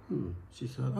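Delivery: ordinary delivery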